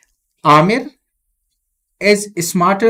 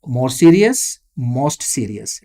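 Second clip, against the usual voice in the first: normal talking voice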